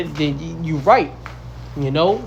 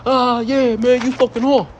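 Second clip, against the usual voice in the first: higher, mocking voice of a scoffer; 7 semitones higher than usual. Preacher imitates fallen brothers laughing at him, higher mocking voice. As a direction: sneer it like the brothers who laughed.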